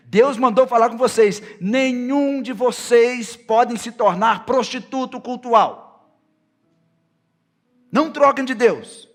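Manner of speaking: loud, high shouted exhortation; full-force preaching. Acted. Preacher voices Moses warning the people against other gods, full-force preaching. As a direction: thunder the command, building to a shouted finish.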